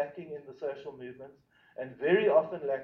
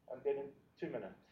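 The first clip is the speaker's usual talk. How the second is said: quick quiet aside; 3 semitones lower, 12 dB quieter, faster than usual. Real, not acted. Aside about the time limit.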